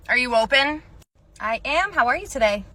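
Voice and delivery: ordinary delivery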